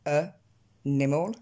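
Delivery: ANIMAL split as "uh", pause, "NEH-mol"; misheard split word. Shown as a demonstration. Teacher showing what an English listener gets, incomprehensibly split word. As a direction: break ANIMAL after a weak first vowel. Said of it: "Lui, ce qu'il voit, c'est ça".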